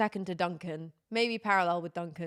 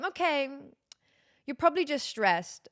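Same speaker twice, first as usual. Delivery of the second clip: higher-pitched patronising doctor impression; dismissive doctor; 6 semitones higher than usual. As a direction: play a doctor brushing the patient off. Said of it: "then they go".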